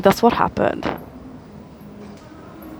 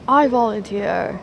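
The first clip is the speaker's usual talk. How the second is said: mock Hunger Games volunteer; 5 dB quieter than usual. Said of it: "like the Hunger Games".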